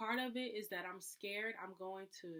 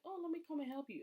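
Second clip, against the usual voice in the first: higher, bright eager helper voice; mock helpful offer; 9 semitones higher, faster than usual. A helper offering to find a lost thing, higher mock-eager. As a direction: offer help a bit too sweetly.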